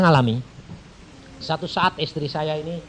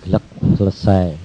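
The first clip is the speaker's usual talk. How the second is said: mock gulp; 8 semitones lower, 8 dB louder than usual. Swallowing sound, preacher acts it.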